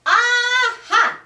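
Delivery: high, loud triumphant cry; crying aha. Acted. Animals cry out finding the tiny frog. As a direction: cry out in discovery. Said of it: "they cried".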